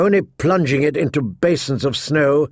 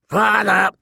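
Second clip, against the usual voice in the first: shrill croaking raven cry; taunting raven; 6 semitones higher than usual. A narrator's reading. The raven mocking Jon again, squawking. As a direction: caw the word out like a bird. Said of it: "taunted the old raven".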